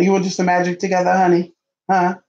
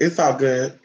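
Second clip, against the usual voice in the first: normal talking voice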